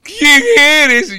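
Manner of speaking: high, loud scared demand; terrified, hammed-up question; frightened farmer. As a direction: cry out in fright. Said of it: "se asusta, pues, el, el señor".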